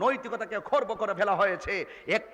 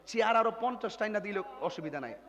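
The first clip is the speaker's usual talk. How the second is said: ordinary talk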